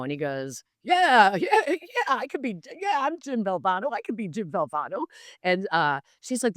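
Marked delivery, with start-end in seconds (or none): 0.8-5.0 high, amused, playful agreement; a laughing, mock-game yes; Dickie V playing along. Dickie V plays along for a fan, high and amused.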